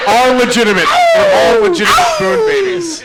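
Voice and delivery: high laughing squeal; laughing excited. Real, not acted. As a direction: gush through laughter.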